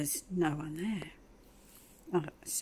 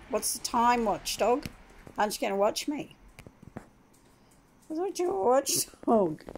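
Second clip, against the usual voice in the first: playful high teasing; teasing the dog; 5 semitones higher than usual. Real, not acted. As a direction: tease the lazy dog, rising up.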